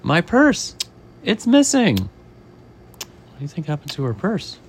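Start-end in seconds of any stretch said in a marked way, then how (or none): 0.0-2.0 high, louder cry of dismay; high-pitched storybook alarm; woman's alarm. Acted. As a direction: discover a loss in a high, raised voice. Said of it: "She says".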